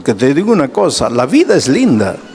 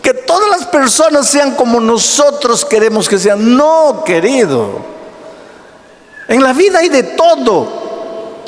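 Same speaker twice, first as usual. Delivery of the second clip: higher, rising sermon voice building to a peak; preaching at full force; 10 semitones higher than usual. Acted. Pastor preaching hard to his church about temper. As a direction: preach it out to the congregation.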